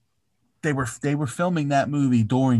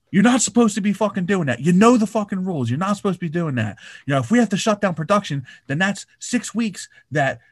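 high, fast mock scolding; imitated scolding; 6 semitones higher, faster than usual. Retelling Tom Cruise scolding rule-breakers, mock anger. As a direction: rattle off the rules like an angry boss. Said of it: "he was like".

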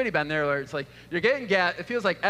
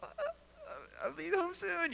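high, soft, nervous stammer; mock meek reply; 12 semitones higher, 10 dB quieter than usual. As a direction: answer your upset wife, high, soft and nervous. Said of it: "I'm like".

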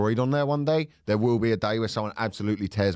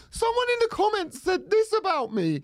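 much higher, mock offended whine; mocking whiny voice. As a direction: imitate a wounded poster, pitched way up. Mocking a self-pitying poster.

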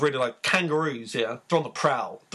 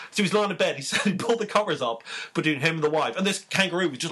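ordinary delivery

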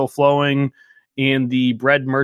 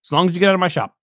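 higher, testy shopkeeper voice; a mildly annoyed merchant who wants them gone; irritated shopkeeper; 6 semitones higher, faster than usual. Acted. Shopkeeper telling the party to take it up with the crown, testy.

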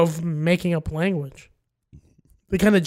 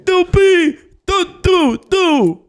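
higher, louder, slow singsong gibberish; high nonsense babble; 11 semitones higher, 6 dB louder, slower than usual. Pretend. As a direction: babble like an alien cashier. Cashier babbles in fake language.

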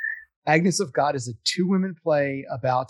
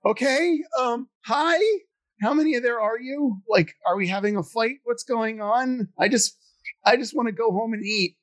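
nervous, much higher voice, fumbling; flustered reply; 7 semitones higher than usual. Retold or imitated. Actor cornered by drunk fans, flustered high-pitched reply. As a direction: squeak it out like a cornered shy guy. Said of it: "It's like".

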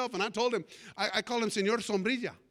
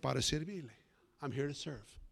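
low, soft, unruffled reply; calm helpful stranger; 8 semitones lower, 8 dB quieter than usual. Retold or imitated. Man replies to the pastor who yelled, quiet and calm. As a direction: answer very gently and low, totally unfazed. Stranger answers the yell calmly.

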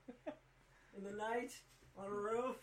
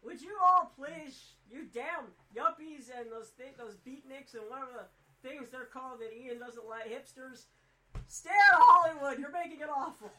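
mock rant; 3 semitones higher, 16 dB louder than usual. Fed-up viewer telling hipsters to stay out of Hollywood, mock rant. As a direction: scold them with a big theatrical shout.

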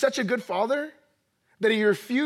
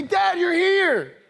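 high, drawn-out delighted greeting; thrilled child; 7 semitones higher, 4 dB louder, much slower than usual. Acted. Son greeting dad who just got home, thrilled. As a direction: imitate a kid overjoyed to see dad.

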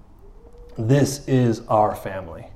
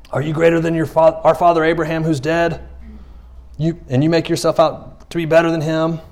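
higher-pitched voicing of indignant challengers; mock challenge; 6 semitones higher than usual. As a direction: put on the doubters' challenge, pitched up.

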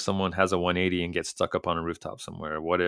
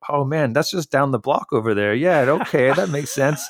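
friendly manager; 8 semitones higher, 6 dB louder than usual. Retold or imitated. Managers relieved, helpful.

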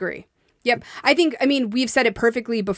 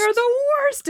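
squeaky high; 14 semitones higher, faster than usual. Real, not acted. Repeating playfully.

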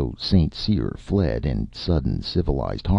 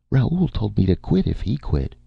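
high-pitched, stubborn female character voice; 7 semitones higher than usual. A narrator's reading. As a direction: dig in obstinately, voice held high. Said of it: "D D said stubbornly".

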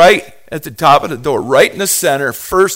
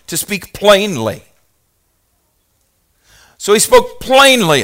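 emphatic preaching; 5 semitones higher, 5 dB louder than usual. Acted. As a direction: proclaim the definition with full force. Reads definition with rising fervor.

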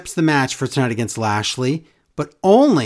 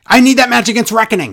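higher, louder, fast mocking voice; mock whiny demand; 6 semitones higher, 6 dB louder, faster than usual. Host mocking Woods demanding a match, high and fast. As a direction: parody an eager wrestler begging on air. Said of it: "Like, he'd go".

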